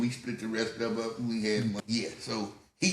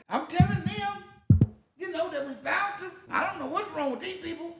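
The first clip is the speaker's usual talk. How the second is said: very high, whiny mock complaint; indignant protest; 4 dB louder than usual. Teen explaining to his daddy at the station, indignant. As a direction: protest your innocence in a squeal.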